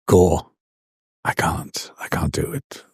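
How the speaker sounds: whispered, pleading that he can't do it; a whispered plea to a friend at a royal dinner; desperate whisper. Retold or imitated. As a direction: hiss the refusal under your breath so the table won't hear.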